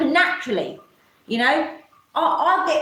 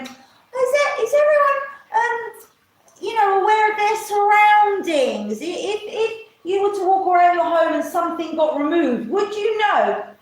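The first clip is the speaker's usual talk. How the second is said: much higher, smug, mocking voice; 5 semitones higher than usual. Mocking smug hosts quizzing the audience, much higher and smug. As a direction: play the smug host, pitched way up. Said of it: "They sit there smugly going".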